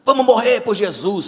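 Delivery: rally the brothers with some fire; fired-up zeal. Acted. Zealous Tomé rallying the others to die for Jesus, fired up. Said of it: "ele é fogo puro".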